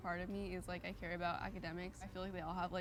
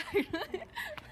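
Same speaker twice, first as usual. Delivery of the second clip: embarrassed high giggle; 4 dB louder than usual. Real, not acted. Flustered over her own stutter.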